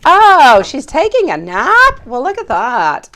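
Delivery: high, loud surprised "oh"; delighted surprise at the news. Real, not acted. Greeting a viewer, high, loud and surprised.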